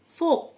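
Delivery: "ফোক" with the L left unsounded; silent L. Shown as a demonstration. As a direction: model "ফোক" with no L before the K. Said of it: "এল silent". ("ফোক", এল উচ্চারণ না করে)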